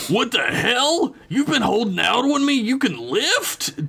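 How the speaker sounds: high, slow, incredulous dad voice; amazed dad. A narrator's reading. Dad discovering his son can lift, high and astonished. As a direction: draw it out in delighted disbelief. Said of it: "a wide grin on his chiseled old face".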